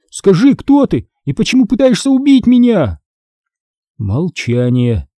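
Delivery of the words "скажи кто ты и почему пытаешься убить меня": high, pleading cry; desperate shout. A narrator's reading. Boy questioning his would-be killer, desperate high cry. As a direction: demand an answer in a raised, high voice. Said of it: "кричал я".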